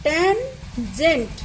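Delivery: ট্যানজেন্ট drawn out slowly, syllable by syllable; teacher modelling ট্যানজেন্ট slowly; pronounce tangent slowly. Shown as a demonstration. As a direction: stretch ট্যানজেন্ট out for the class. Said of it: "তিন নম্বরটা দেখো".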